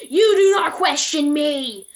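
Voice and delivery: enraged caller yelling a murder threat; furious threat. Retold or imitated. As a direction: scream the threat down the phone. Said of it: "this made him even more mad".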